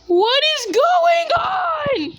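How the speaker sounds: slow, high-pitched wail; high exasperated cry. Acted. Carl confused by the game, high slow wail. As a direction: wail it out in bewilderment.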